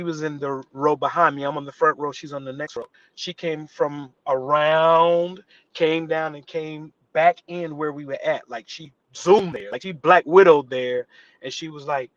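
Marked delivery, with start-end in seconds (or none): none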